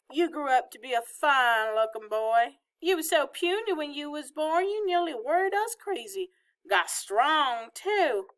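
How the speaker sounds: put-on high, lilting old Southern woman; fond Southern grandmother. A narrator's reading. Grandmother looking over her grandson, fondly fussing.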